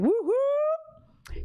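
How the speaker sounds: high, drawn-out whoop; cheering woo-hoo. Real, not acted. Host thanking a supportive viewer, cheering whoop.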